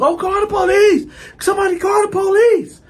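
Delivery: mock shrieking bystander, very high and loud; panicked woman. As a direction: cry out for help in falsetto.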